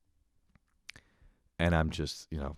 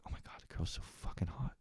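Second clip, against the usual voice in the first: soft whispered awe; whispered, awestruck inner thought; 16 dB quieter, faster than usual. Retold or imitated. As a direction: breathe it to yourself. Inner thought about the girl.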